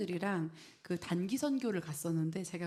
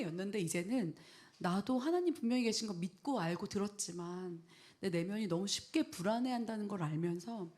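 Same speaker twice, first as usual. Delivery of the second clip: ordinary talk, nothing marked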